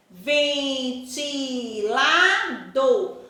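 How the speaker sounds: "Ventilador" very slowly, ven-ti-la-dor, each syllable stretched. Shown as a demonstration. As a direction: sound out "Ventilador" in long syllables, with "dor" as the strong one. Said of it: "O do é a sílaba mais forte". ("Ventilador" esticado, sílaba por sílaba, com "dor" forte)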